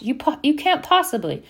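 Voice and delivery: high-pitched, stammered disbelief; shocked. A narrator's reading. Hermione objecting to Harry's threat, shocked. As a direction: protest as if you can't believe it. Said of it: "Hermione, sounding shocked".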